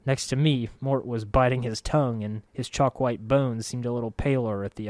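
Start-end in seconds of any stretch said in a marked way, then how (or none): none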